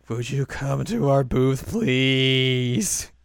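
slow, loud pleading to come along; voiced Hanamaru. Hanamaru asking Chica over to the booth, slow plea. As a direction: stretch out an imploring invitation.

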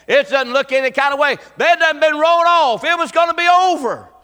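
high, loud lament of a despairing family; family's despair. Retold or imitated. Family tells the pastor it is over, loud lament. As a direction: cry out that all hope is gone. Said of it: "the family said".

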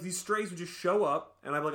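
usual speaking voice

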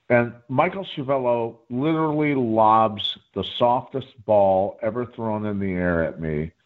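ordinary delivery

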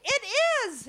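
high, emphatic mock "it is"; emphatic agreement. Grandma confirming the woman's realization, emphatic. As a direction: agree with hammed-up excitement.

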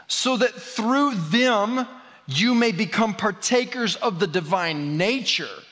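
slow, emphatic reading; slow scripture reading. Acted. Preacher reading Second Peter to the church, slow and emphatic. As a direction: lean on every word of scripture.

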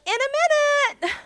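high, lilting, too busy to come; sing-song brush-off, very high; distracted girl voice. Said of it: "Dottie's like".

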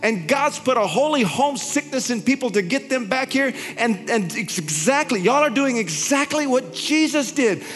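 high-pitched, fervent sermon voice, slowing on the big lines; impassioned preaching. Retold or imitated. Preacher retelling his prophetic words to Nella, in fervent high preaching. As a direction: preach it at full force, voice lifted high.

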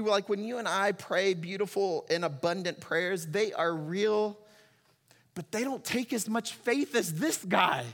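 normal talking voice

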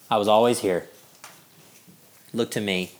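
low, soft, unhurried voice of God; quietly reassure, pitched well down; gentle reminder. Acted. God reminding a doubting believer, gentle reminder.

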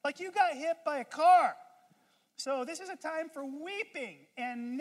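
high, loud scolding of the cheerful one; exasperated Eeyore rant. Pretend. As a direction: berate him like he's lost his mind. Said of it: "us Eeyores think".

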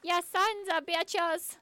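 high, slow Minnesota hockey mom; thick Minnesota accent. Retold or imitated. Imitating a drunk hockey mom cursing, Minnesota accent. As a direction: heckle in a put-on Minnesota accent. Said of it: "really thick Minnesota accent".

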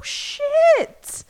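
very high, stunned curse; shocked high squeal. Retold or imitated. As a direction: squeal it in alarm. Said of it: "you're like".

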